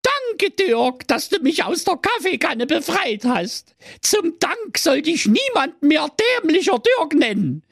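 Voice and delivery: very high-pitched granny proclamation; grateful grandma calling out. Acted. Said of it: "Und sie rief". (hoch rufende Kaffee-Oma, feierlich)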